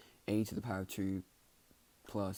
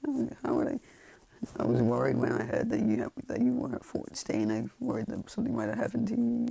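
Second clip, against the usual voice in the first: acted upset, louder and rising high; mock tearful outburst climbing in pitch; a little tearful; 4 semitones higher, 7 dB louder than usual. Worried girl confronting the boy, slightly tearful retelling. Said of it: "she's kinda crying a little bit".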